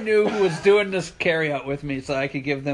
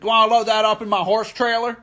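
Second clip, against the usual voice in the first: higher, louder put-on cowboy voice; cowboy customer; 6 semitones higher, 5 dB louder than usual. Imitating cowboy customer collecting his armoire, cowboy voice. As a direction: holler it like a cowboy.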